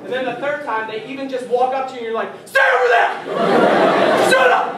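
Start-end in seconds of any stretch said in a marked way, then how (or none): 2.4-4.7 much higher, louder sudden yell; freaking out. Retold or imitated. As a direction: snap and explode at the pest. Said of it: "We freak out on them".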